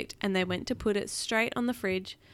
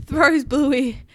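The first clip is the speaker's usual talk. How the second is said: ordinary delivery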